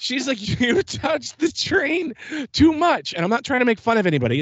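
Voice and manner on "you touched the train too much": much higher, slower put-on voice of a stern ride attendant; imitating the lady. Recounting how the attendant at the ride booth called him back, mimicked high and slow. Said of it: "This is how she sounded".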